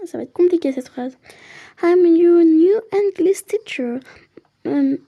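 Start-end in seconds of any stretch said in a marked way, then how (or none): 1.8-4.0 put-on slow, heavy French accent, louder; bad French accent in English. Player reading the teacher's English line in a French accent. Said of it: "bonjour mon accent".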